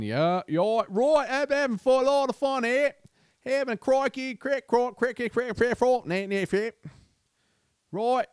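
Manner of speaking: a hammed-up, botched Aussie fan voice; bad Australian accent. Imitating an Australian fan on the street, in a bad Australian accent. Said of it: "I can't do an Australian accent".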